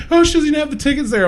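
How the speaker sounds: fast, high-pitched mock panic; freaking out. Imitating a friend worried about missing tickets, freaking out. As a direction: rush it out high, flustered. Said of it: "Andrea's freaking out".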